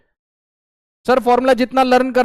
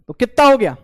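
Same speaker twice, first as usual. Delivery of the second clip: ordinary delivery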